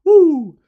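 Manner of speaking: loud, high-pitched "woo"; high whoop. Real, not acted. Host breaking out of his French impression, high whoop. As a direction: let out a sudden loud whoop.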